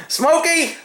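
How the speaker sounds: slowly dote on the cat; real fond kitty voice; affectionate pet talk. Real, not acted. Praises his cat.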